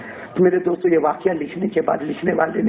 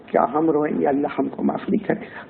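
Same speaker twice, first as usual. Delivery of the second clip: ordinary talk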